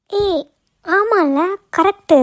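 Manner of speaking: light up as it dawns on you; sudden realization. Acted. Friend suddenly remembers her habit. (திடீரென புரிந்த வியப்பில்)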